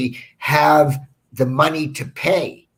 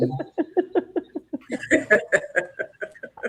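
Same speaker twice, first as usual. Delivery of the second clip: laughing; 11 semitones higher, 4 dB quieter than usual. Real, not acted.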